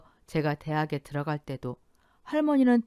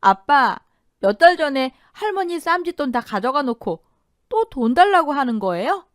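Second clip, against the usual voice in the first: outraged shout; 9 semitones higher, 9 dB louder than usual. A narrator's reading. Daughter shouts at father.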